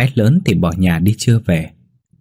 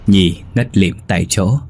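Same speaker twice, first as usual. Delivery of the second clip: plain reading voice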